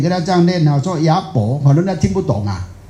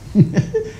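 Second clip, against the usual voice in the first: short laugh; 14 semitones higher than usual. Real, not acted. Joke about 白目.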